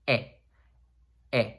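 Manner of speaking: "e" com um leve sorriso; smiling E. Shown as a demonstration. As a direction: mostre os dentes de cima ao dizer "e". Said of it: "the slight little, smile".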